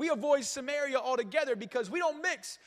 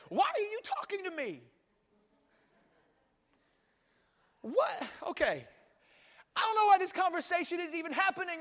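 indignant Samaritan woman; 5 semitones higher than usual. Acted. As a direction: push him away with incredulous, high-voiced attitude. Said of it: "she probably sounds a little something like this".

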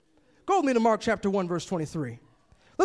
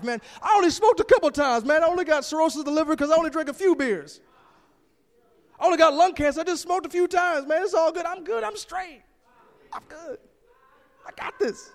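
casual addict; 5 semitones higher than usual. Retold or imitated. Preacher voicing an addict brushing off a friend's warning, lightly imitated.

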